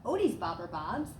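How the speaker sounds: plain reading voice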